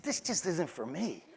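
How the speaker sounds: softly retold, understated confession; quiet admission. Student telling guidance counselor school isn't for him, quiet admission. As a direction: drop the volume and admit it gently.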